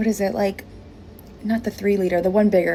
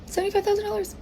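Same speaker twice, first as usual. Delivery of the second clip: much higher, squeaky disbelief; astonished squeal; 13 semitones higher, much faster than usual. Real, not acted. Bartender repeating the tip amount in disbelief. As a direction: squeal the number in shock.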